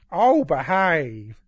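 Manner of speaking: high, loud, drawn-out camp catchphrase; exaggerated impression, slow and loud; Alan Carr. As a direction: stretch it out in a comic celebrity voice. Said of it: "did that in Alan Carr's voice".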